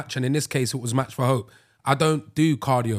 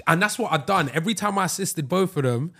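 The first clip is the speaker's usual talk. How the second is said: ordinary delivery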